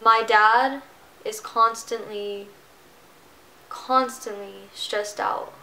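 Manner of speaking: ordinary talk, nothing marked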